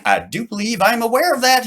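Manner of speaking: high, prim know-it-all; haughty boy. Acted. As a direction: puff up like a little lord. Charlie acts grown-up.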